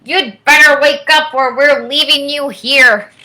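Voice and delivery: a sister tugging you awake, half calling; big sister calling you to wake up; sister waking me. Acted. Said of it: "She tugged on my sweater and spoke in my ear".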